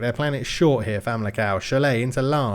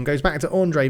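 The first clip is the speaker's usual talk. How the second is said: usual speaking voice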